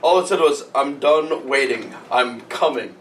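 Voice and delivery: usual speaking voice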